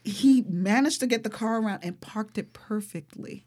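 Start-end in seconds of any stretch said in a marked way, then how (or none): 1.8-3.4 awed whisper. Real, not acted. Awed retelling of the miracle.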